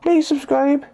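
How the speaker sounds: high squeaky kitten voice; cat voice. Pretend. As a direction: make the kitten plead cutely. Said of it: "So tell him".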